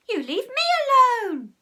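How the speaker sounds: high, loud shriek back; scream. A narrator's reading. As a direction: scream it right back at him. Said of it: "screamed Peter".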